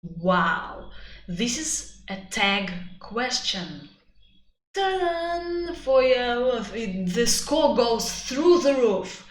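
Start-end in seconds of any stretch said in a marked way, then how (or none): 4.7-5.8 playful fanfare. Real, not acted. Presenting the tag question. 5.8-9.2 excited praise. Real, not acted. Praising the score boost.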